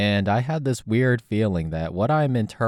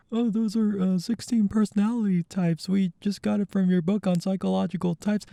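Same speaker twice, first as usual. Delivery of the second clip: high, timid explaining; meek high fan voice; 12 semitones higher than usual. Acted. Fan meekly explains the sixteen types to Jung, high timid voice. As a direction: sheepishly justify yourself.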